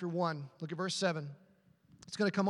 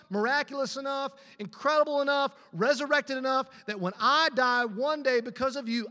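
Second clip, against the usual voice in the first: loud, high, impassioned preaching; full-force preaching; 6 semitones higher, 5 dB louder than usual. Acted. Preacher pleading to God before the congregation, loud and impassioned. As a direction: pour it out to the congregation. Preacher voicing a desperate prayer.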